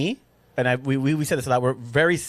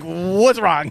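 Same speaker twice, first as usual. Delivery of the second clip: mock concerned husband; 4 semitones higher, 5 dB louder than usual. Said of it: "I'm like".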